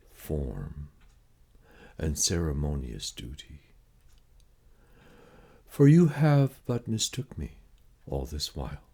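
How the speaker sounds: ordinary talk, nothing marked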